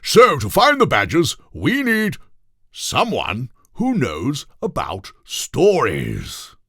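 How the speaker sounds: a pompous little king laying out the plan; silly royal voice, very high and slow; King Toothbrush Weasel voice. A narrator's reading. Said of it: "said King Toothbrush Weasel dramatically".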